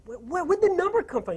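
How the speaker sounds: high-pitched baffled stammer; exasperated bewilderment. Retold or imitated. Retold reaction to a rushed message.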